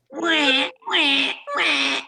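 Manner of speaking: loud mock wah-wah, high; sad trombone wah. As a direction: sing the sad trombone sting. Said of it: "try out a few things".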